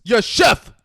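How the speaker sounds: loud, high kitchen-crew shout; yelling in reply. Retold or imitated. Kitchen staff answering the chef, yelled reply. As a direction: yell it back like the whole brigade. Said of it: "everyone yells".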